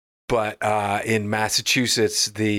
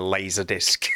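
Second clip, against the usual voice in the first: put-on laserdisc in a lightly done Aussie accent, lower; Australian accent; 4 semitones lower than usual. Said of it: "imitation keyword for Australian accent".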